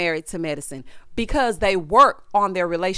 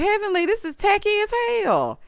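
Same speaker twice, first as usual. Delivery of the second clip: mock scolding; 10 semitones higher than usual. Acts out her scolding of Heavenly.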